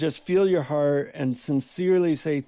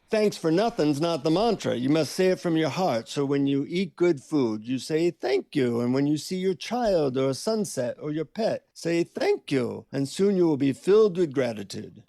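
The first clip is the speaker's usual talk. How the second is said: put-on Indian-accented guru; 2 semitones higher than usual. Guru teaches the annoyed seeker to say thanks, Indian accent. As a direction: instruct patiently as an Indian guru. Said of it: "in an Indian accent, he says".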